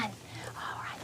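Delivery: soft whispered aside; teacher whispering before the next verse. Real, not acted. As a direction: whisper it under the song.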